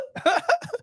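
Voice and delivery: a short high burst of laughter. Real, not acted. Vlogger laughing at a viewer, high laugh.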